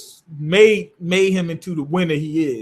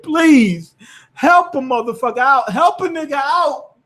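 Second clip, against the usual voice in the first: mock pleading; 6 semitones higher than usual. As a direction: imitate a desperate plea for help. Imitating player begging teammates for help.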